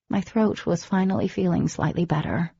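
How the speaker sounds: ordinary talk, nothing marked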